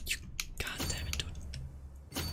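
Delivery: soft exasperated whisper; frustrated whispered curse. Real, not acted. Streamer annoyed at the game, cursing in a whisper. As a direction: hiss the curse under your breath.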